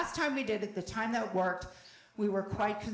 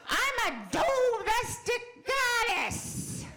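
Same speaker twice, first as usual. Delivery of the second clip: Roseanne catchphrase; 12 semitones higher than usual. Retold or imitated.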